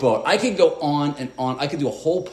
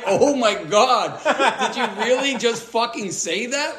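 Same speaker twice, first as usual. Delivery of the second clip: slow, high, laughing disbelief; laughing in shock; 8 semitones higher, slower than usual. Retold or imitated. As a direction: laugh out the words in horror. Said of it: "I'm like".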